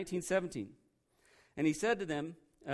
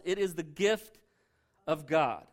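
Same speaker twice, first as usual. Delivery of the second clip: normal talking voice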